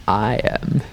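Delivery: normal talking voice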